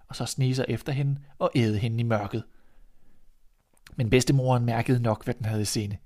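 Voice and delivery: plain reading voice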